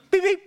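high-pitched polite horn imitation; dainty car-horn beep; nice little honk. Driver nudging a car stopped at the green, nice polite beep. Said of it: "the nice one".

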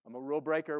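low, proud rule breaker boast; lightly voiced rebel calling out. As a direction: brag about breaking rules, voice dropped low. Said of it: "You'd say".

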